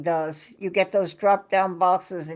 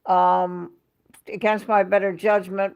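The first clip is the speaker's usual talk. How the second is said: ordinary talk, nothing marked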